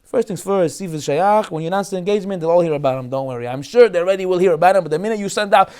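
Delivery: ordinary talk, nothing marked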